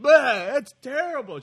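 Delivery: loud, high blurt of disgust; disgusted outburst. Retold or imitated. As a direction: recoil and yell it out in disgust. Retelling disgusted reaction to bad dip.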